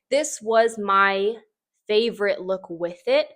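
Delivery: normal talking voice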